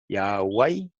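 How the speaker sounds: "Yahweh" leído como ia-uai; pronunciación demostrada. Shown as a demonstration. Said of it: "así como lo voy a leer". ("Yahweh" dicho ia-uai)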